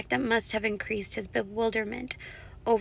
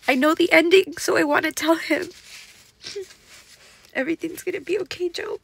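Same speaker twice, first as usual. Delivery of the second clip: higher and louder, crying it out; tearful outburst; 7 semitones higher, 8 dB louder than usual. Real, not acted. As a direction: burst out through tears. Crying over Job's story.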